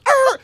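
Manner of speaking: sudden high yell like a tire bursting; startled yell. Retold or imitated. Tire pops on the road, a high startled yell. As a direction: let out a sudden shrieking yell.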